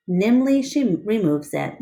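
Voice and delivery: ordinary delivery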